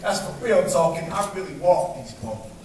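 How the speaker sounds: rhythmic spoken word performance, building and falling; spoken word poem. Acted. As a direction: perform it like a slam poem on an open mic stage.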